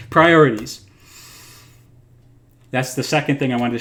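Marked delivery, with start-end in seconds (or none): 0.1-0.9 priorities said again, much louder, falling firmly; louder restatement. Real, not acted. Speaker to viewers, regaining composure, louder and firm. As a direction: land the word priorities with force. Repeated louder after a laugh.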